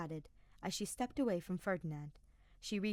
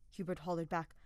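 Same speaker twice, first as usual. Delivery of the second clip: ordinary delivery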